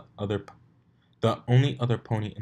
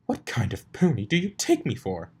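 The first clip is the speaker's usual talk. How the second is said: indignant; 4 semitones higher, 5 dB louder than usual. Acted. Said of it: "Indignation overpowered her confusion".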